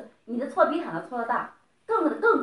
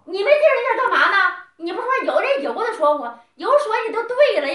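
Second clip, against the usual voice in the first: higher and louder, exasperated yelling; angry outburst; 7 semitones higher, 8 dB louder than usual. Real, not acted. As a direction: blast back at his denial. Heated quarrel, disputing his denial.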